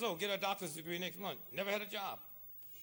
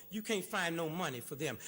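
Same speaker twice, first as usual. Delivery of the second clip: ordinary talk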